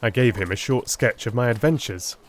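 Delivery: ordinary delivery, nothing marked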